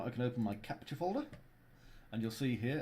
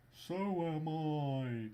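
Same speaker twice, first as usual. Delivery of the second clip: higher, very slow Scandinavian voice; high Scandinavian accent; 6 semitones higher, much slower than usual. Pretend. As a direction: stretch it high in a Nordic lilt. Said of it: "that's why I'm doing the Scandinavian accent".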